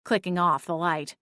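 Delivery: ordinary delivery, nothing marked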